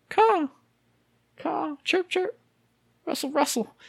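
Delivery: high, soft imitated caws and chirps; bird calls. Shown as a demonstration. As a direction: chirp like waking birds in the forest. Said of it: "imitating birds at sunrise".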